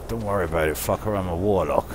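normal talking voice

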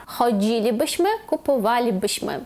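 choDZIlibyśmy, kupoWAlibyśmy; fourth-from-last stress. Shown as a demonstration. Teacher repeating the plural conditional, stress fourth from end. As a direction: lean on DZI and WA in "Chodzilibyśmy, kupowalibyśmy". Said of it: "Четвертий склад з кінця".

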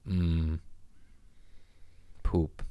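ordinary delivery

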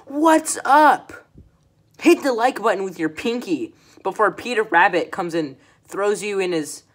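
loud imitation of a yelling YouTuber; hammed-up shouty video intro; mock screaming. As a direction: parody her by bellowing at the viewer. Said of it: "screaming at me".

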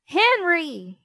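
loud call from the kitchen; shouting. A narrator's reading. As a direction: shout his name across the house. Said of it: "shouted Mom from the kitchen".

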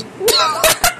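squeaky rising laugh; high laugh. Real, not acted. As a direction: let a high laugh slip out. Reacting to chat.

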